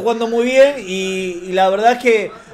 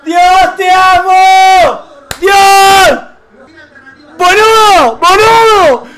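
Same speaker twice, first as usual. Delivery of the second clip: very high, sustained screaming; ecstatic goal screaming; 10 semitones higher, 4 dB louder, slower than usual. Real, not acted. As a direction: scream in disbelief, stretching the words. Festeja gol increíble en vivo. (gritos agudos de gol, desaforado)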